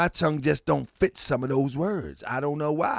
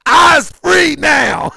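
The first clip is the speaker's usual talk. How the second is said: mock triumphant shout; 9 semitones higher, 9 dB louder, much slower than usual. Triumphant shout about freedom.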